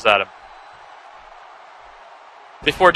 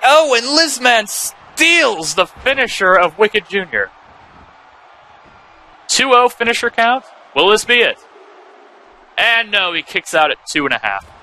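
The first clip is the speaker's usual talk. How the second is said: wrestling commentator; 7 semitones higher than usual. Real, not acted. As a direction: sell the stolen finisher like a ringside announcer. Hyped play-by-play of a near fall.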